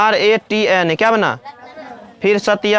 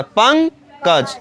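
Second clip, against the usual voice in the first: drawn-out word; much slower than usual. Shown as a demonstration. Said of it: "फिर पंकज है".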